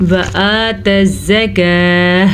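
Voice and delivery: slow, drawn-out Quran recitation; tajweed recitation. Shown as a demonstration. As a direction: chant it slowly, stretching the vowels. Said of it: "کھڑی زبر کا دھیان رکھنا ہے". (تجوید کے ساتھ آہستہ تلاوت)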